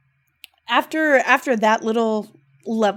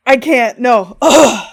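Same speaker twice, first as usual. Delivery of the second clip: upset groan; 2 semitones higher, 11 dB louder, slower than usual. Real, not acted. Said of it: "I'm gonna start crying".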